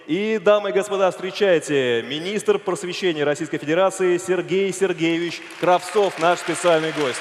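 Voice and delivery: loud, slow, drawn-out grand announcement; ringmaster. Acted. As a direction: boom it out like a circus ringmaster. Host introduces the minister.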